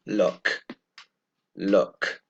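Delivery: "look" with a hard, airy final k; model pronunciation. Shown as a demonstration. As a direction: say "look" slowly, crisp k. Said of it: "you can hear it's a hard consonant".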